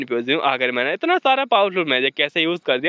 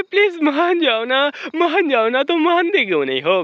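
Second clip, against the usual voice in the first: loving plea; 7 semitones higher than usual. A narrator's reading. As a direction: wheedle lovingly, stretching the first plea. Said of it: "बड़े प्यार से कह रही होती है". (लाड़ से, ऊँची आवाज़ में खींचकर मनाना)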